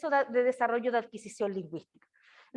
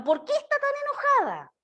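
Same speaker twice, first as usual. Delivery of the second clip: put-on much higher, sing-song question to a little girl; high baby talk; 12 semitones higher than usual. Mother asking her fussing daughter, in high baby talk. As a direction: lift it way up like talking to a toddler. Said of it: "lo que le decía yo a mi hija".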